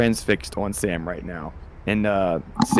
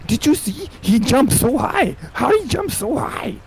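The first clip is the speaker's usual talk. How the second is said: squeaky high amazed voice, slow; excited bystander; 4 dB louder than usual. Acted. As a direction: gasp at the jump in a falsetto. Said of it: "he's like".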